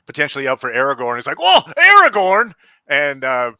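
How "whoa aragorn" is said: high, loud burst of amazement; imitated wide-eyed excitement; excited son. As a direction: blurt it out as a thrilled fan. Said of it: "his son was so excited about it".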